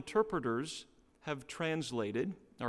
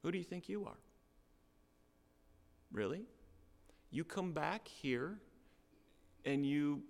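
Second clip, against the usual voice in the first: softer, contemptuous put-down; negative, dismissive; 5 dB quieter than usual. Shown as a demonstration. Hometown crowd to Jesus, soft contemptuous dismissal. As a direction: cut him down to size with scorn. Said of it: "There's also a negative".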